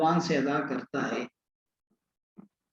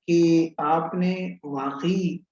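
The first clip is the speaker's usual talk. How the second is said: slow emphasis; much slower than usual. Shown as a demonstration. Teacher drags out the key word.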